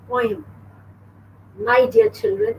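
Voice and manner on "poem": poem run into one syllable, "poym"; poem with an OY sound, one beat; children's mispronunciation. Shown as a demonstration. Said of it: "children tend to say".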